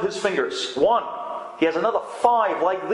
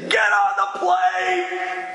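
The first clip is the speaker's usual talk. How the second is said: father yelling at son over the phone; imagined angry shout; 13 semitones higher, much slower than usual. Retold or imitated. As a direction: bellow it like an enraged dad. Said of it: "start shaking and say".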